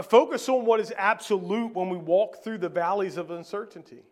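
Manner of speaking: ordinary talk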